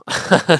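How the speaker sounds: a quick sheepish laugh; short laugh. Real, not acted. Teacher laughs at being sleepy, short laugh. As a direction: laugh it off, just woken up.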